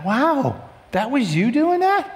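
wondering disbelief, rising at the end; astonished realisation. Acted. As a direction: pitch climbing high into a question. Acted astonished realisation about God.

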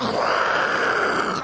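voiceless rushing whoosh; imitated air blast. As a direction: blow out a hard gust of noise.

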